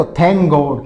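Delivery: loud, careful demo; loud model phrase. Shown as a demonstration. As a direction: present it to the class. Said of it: "फिर देखो".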